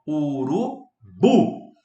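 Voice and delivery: Urubu drawn out slowly, final BU punched loud; stress on last syllable. Shown as a demonstration. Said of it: "A sílaba tônica é a última". (Urubu bem devagar, com força no BU final)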